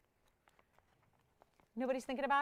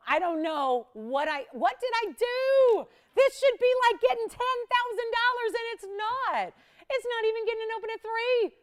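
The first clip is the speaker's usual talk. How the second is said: mock despair; 12 semitones higher, 9 dB louder than usual. No bids on ring, mock despair.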